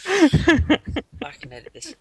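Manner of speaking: giggling through the words; high, slow, laughing it off. Real, not acted. Laughing after "Unicorn!" outburst.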